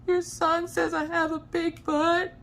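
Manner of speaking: whiny complaint. Retold or imitated. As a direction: tattle like a wounded child. Complaining to husband about son.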